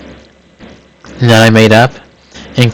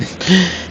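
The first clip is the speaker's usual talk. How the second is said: short laugh; 8 semitones higher, 7 dB quieter than usual. Real, not acted. Speaker laughs at own joke.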